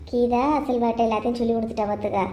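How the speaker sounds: ordinary talk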